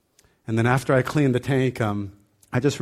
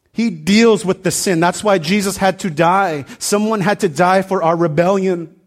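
loud, high, emphatic preaching; preaching at full force; 6 semitones higher, 7 dB louder than usual. Acted. Pastor telling the church they need the gospel, loud and driving. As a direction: drive the point home to the congregation.

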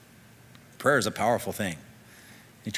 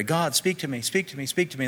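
higher, repeated mock plea to God; acted pleading prayer; 5 semitones higher than usual. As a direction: plead insistently as if praying. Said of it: "sometimes we pray".

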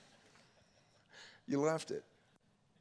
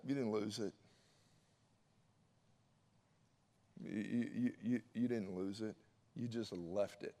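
much softer, close and hushed; soft intimate point; 8 semitones lower, 9 dB quieter than usual. Acted. Pastor quietly driving home to Tiffany, softer. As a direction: lean in and say it gently.

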